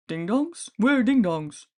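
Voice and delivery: lightly voiced hungry monsters; hungry monster mutter. A narrator's reading. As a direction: mumble after the snacks. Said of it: "Several muttered hungrily".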